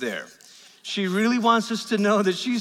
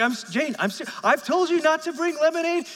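exasperated dad; 10 semitones higher than usual. Retold or imitated. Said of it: "I'm like".

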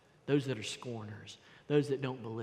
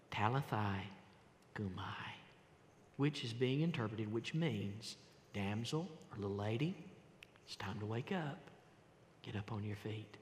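put-on soft, tender call to the girl, much quieter; gentle wake-up call; 5 semitones lower, 9 dB quieter than usual. Jesus to the dead girl, tender and soft. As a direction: coax her awake gently.

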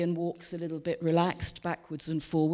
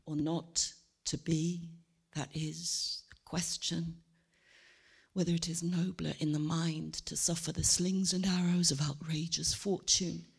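Hamlet soliloquy; 7 dB quieter than usual. Shown as a demonstration.

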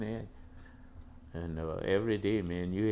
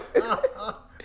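high laugh; 12 semitones higher, 5 dB louder than usual. Real, not acted. Laughing at classroom memory.

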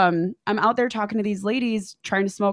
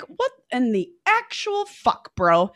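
high, slow disbelief; exasperated; 5 semitones higher, slower than usual. Retold or imitated. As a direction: stretch it out in disbelief. Furious at tripping date.

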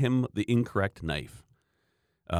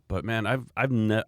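ordinary delivery

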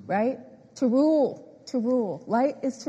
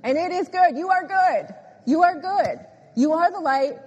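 building emphatic declaration, rising higher; preacher's fervent affirmation, pitched up; excited and emphatic; 5 semitones higher than usual. Real, not acted. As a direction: drive each short declaration home. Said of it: "this just really got me excited".

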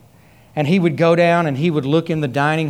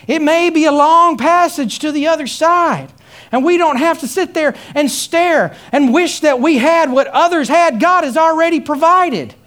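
high, loud, ringing preacher cadence; full-force preaching; 11 semitones higher, 5 dB louder than usual. Acted. Preacher rousing the congregation about God's provision, full-force preaching. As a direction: preach it out to the whole church.

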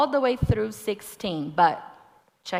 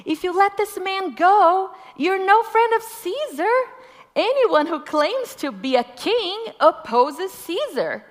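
high, loud shouted threat; shouting; 11 semitones higher, 8 dB louder than usual. Acted. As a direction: yell it at the governor.

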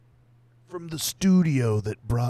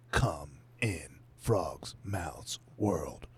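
slow, low whispered tagline; whispered trailer tagline; 4 semitones lower, 4 dB quieter, much slower than usual. Pretend. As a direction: breathe the title like a trailer.

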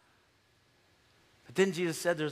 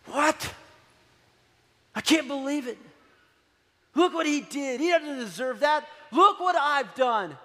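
put-on angry older brother; 7 semitones higher, 4 dB louder than usual. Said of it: "He just gets angry".

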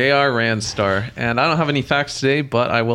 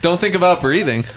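normal talking voice

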